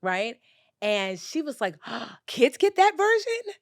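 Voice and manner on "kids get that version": high, loud, drawn-out astonishment; gasp, then a slow high question as an astonished little girl; amazed child. Retold or imitated. Niece amazed that kids get this song, astonished.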